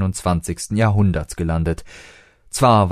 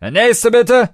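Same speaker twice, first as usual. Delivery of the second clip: bouncer; 8 dB louder, slower than usual. A narrator's reading. Bouncer waves them off.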